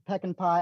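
"Pecan" said PECK-in; unusual pecan pronunciation. Real, not acted. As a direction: say "Pecan" as PECK-in, stressed first. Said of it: "did you say pecan as pecan".

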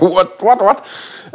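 high, rapid bewildered whats; startled confusion. Retold or imitated. Retold startled reaction on waking.

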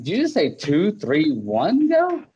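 high-pitched incredulous question, mock shock; retold disbelief. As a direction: question her botched count in exaggerated disbelief. Retold disbelief at the botched countdown.

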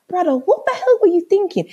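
high-pitched vexed scolding. Retold or imitated. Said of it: "I was so vexed".

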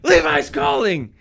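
very high, shouting overexcited mom; mocking imitation. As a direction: yell for silence, giddy. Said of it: "Erica does this thing".

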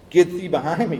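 a touch of stern command; sharp rebuke. Retold or imitated. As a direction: throw it out as a sudden loud reprimand. Acting out Jesus rebuking Peter.

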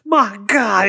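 high dramatic commentary wail; Jim Ross call. Pretend. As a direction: cry out like a stunned announcer.

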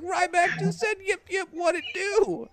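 high squeaky mock quote; mock disbelief. Host retelling his reaction to Ryback's catchphrase, high mock squeal. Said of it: "I was like".

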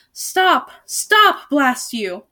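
enraged bellow; furious bellow. A narrator's reading. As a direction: yell after a fleeing dragon. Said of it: "bellowed the one-eyed Duke".

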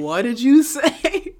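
loud, slow, high challenge; half-laughing patriotic indignation, drawn out; mock outrage. Host confronting British critics, mock outrage.

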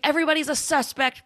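fast, high mock yell; screaming. Horror-fan clerk yelling his theory, quick high mock shout. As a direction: blurt it out high and quick, fired up. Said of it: "he's screaming".

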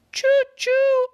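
very high loud choo choo; kitty cat train tooting loud and high; train whistle. A narrator's reading. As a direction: toot like a toy train. Kitty cat answers Morgan.

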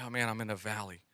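low, soft, deflated mumble; a worn-out believer sinking into a mock slump; discouraged sigh. Churchgoer imitating a weary believer in a valley, low and deflated. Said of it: "have you ever been in that place where".

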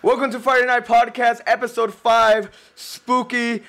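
podcast host intro. Acted. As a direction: announce the show like a host. Host formally opens the episode.

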